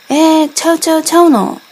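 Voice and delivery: a loud, disbelieving Kansai comeback; surprised Kansai retort. Acted. Doubting the answer about the dog, loud surprised Kansai retort. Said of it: "面白い関西弁も".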